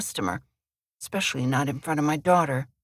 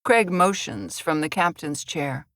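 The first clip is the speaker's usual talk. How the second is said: ordinary delivery, nothing marked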